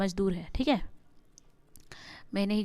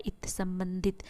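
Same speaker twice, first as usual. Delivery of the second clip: संबंधित whispered softly, no voice; teacher whispers संबंधित to the class; whispered answer; 2 semitones lower, 6 dB quieter, slower than usual. Shown as a demonstration. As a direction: breathe संबंधित like a secret answer.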